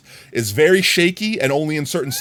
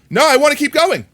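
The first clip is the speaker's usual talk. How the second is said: higher, louder indignant insistence; the wronged customer pushing back, raised pitch; 13 semitones higher, 6 dB louder than usual. Retold or imitated. Customer refusing a settlement, high indignant voice. Acted company versus customer.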